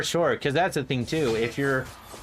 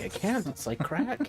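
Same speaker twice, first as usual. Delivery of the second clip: mock confession; 9 semitones higher, 3 dB quieter than usual. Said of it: "And it's like".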